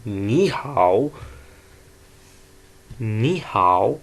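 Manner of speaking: loud slow Mandarin tone drill of नी हाओ; third tone demo. Shown as a demonstration. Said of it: "जिस तरह example देख लें".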